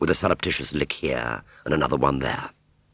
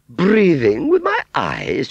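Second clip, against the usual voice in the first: high, loud, slow incredulous echo; sneering; 11 semitones higher, 6 dB louder, slower than usual. Acted. Aunt Sally mocking Wurzel's claim, sneering. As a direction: repeat it back with drawn-out scorn. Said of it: "she sneered".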